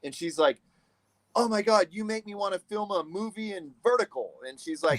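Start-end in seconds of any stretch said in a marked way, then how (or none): 1.3-4.3 high, gushing imitation of a delighted boss; retold starstruck exclamation; amazed praise. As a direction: act out being blown away, pitch up. Retelling the D P's delight.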